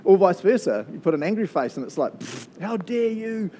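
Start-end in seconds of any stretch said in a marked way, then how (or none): 2.2-3.5 high, slow scoff of mock offense; hammed-up pfft, how dare you; mock indignation. Preacher mocking an online angry-face reply, scoffing.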